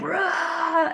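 high, laughing imitation roar; half-laughing "rah" of a roar; mock roar. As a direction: roar playfully, high and drawn out. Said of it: "like, roaring".

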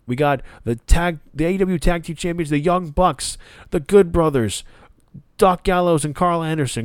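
lightly voiced mock Don Callis bragging; Don Callis hype. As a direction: puff up and present the champions like a proud manager.

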